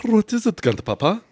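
character voice. Pretend. Repeats the character voice.

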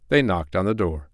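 usual speaking voice

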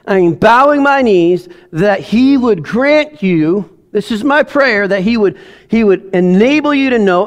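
high, slowed, fervent reading; preaching a prayer from Paul; scripture prayer reading. Acted. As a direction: pray it aloud over them. Reads Ephesians as his prayer.

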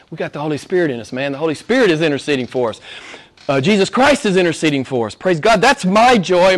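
ordinary talk

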